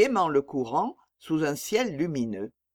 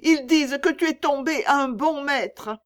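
put-on high, raised child's voice; child crying out; 10 semitones higher, 5 dB louder than usual. A narrator's reading. Child telling mother of her new master, high earnest cry. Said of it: "cria l'enfant".

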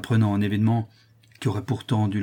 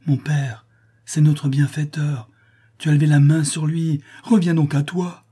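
high, insistent appeal, growing louder; urgent pleading; 6 semitones higher, 5 dB louder than usual. Acted. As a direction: beg your father to come to his senses. Daughter begs maddened father.

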